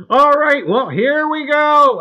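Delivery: high, loud launch into the show; loud, high start to the stream; excited kickoff. Real, not acted. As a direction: fire up the audience. Host kicks off the show.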